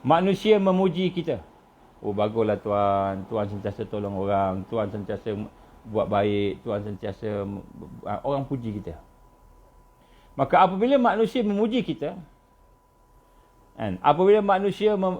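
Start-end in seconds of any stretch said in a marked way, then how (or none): 2.0-8.9 flattering praise. Retold or imitated. A hint of admirers flattering the preacher. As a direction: mimic people gushing over you.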